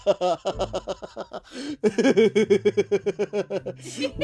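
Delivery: laughing hard. Real, not acted. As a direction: struggle through the words while cracking up. Laughing at mounting gambling losses.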